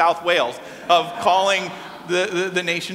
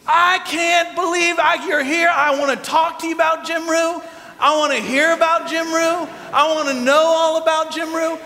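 high, hollered enthusiasm; high-pitched, eager booming voice; excited dad; 9 semitones higher than usual. Retold or imitated. Dad excitedly quizzing Beth about Wales.

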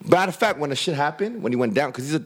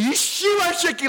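high mock taunt; mock thug taunt. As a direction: ham up a gangster insult. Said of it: "I was gonna be like".

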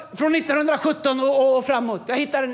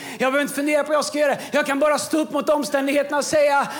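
usual speaking voice

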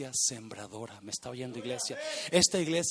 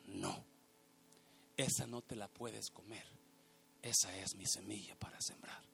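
father's voice; 7 semitones lower, 10 dB quieter than usual. Retold or imitated. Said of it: "Mi papá le dijo".

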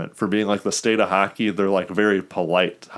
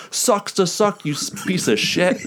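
rude rival fan; 10 semitones higher than usual. Retold or imitated. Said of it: "some Boston or Philly fan and be like".

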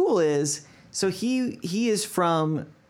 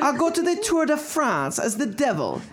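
high hammed-up German accent; bad German accent; 9 semitones higher than usual. Quoting the devil cyclist in a bad German accent. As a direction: overplay the German vowels. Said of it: "That was a terrible accent".

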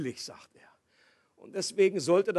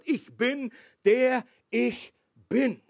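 "Ich bin, der ich bin" slowed, each word set apart; emphatic; 4 semitones higher, 5 dB louder, much slower than usual. Shown as a demonstration. Preacher stresses the key content to the congregation, emphatic. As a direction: land "bin" loud and drawn out. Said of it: "das Wichtige ist der Inhalt".

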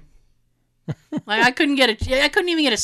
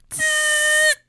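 sharp hiss through teeth; hissing in pain. Retold or imitated. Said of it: "He's like".

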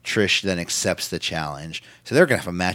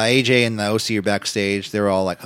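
normal talking voice

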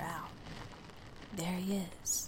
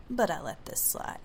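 ordinary delivery

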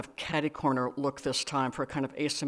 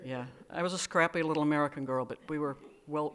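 ordinary delivery, nothing marked

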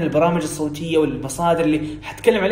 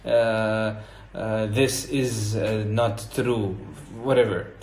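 low, slow, hesitant Arabic-accented English; broken Arabic accent; 6 semitones lower, 4 dB quieter, much slower than usual. Shown as a demonstration. Teacher imitating our broken English for learners, heavy-tongued Arabic accent. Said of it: "حتى لو بتتكلم باللهجة العربية المكسورة حقتنا".

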